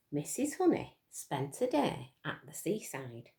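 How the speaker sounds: usual speaking voice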